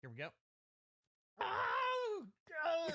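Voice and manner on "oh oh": loud high yelp at the sour taste; sour pained cry. Real, not acted. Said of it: "That sounded like an Andrew Dice Clay impression".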